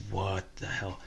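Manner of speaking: muttered disbelief. Retold or imitated. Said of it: "I'm like".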